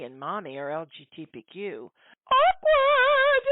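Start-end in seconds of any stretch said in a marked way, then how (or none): 2.3-3.5 awkward squealed far higher and louder; sarcastic awkward. Acted. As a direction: sing it out as a mocking punchline. Sarcastic punchline after parents.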